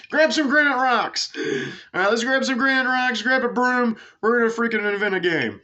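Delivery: very high, strained excited holler; rally the drunken gang in a hammed-up shout; playful high-pitched bellowing; mock drunken yelling. Drunk Scots calling out to each other.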